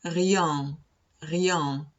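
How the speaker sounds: rien with a French r and nasal end. Shown as a demonstration.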